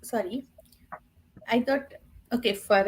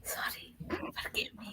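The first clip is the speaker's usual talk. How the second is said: whispering; 19 dB quieter than usual. Real, not acted. Scolded for chewing, apologises.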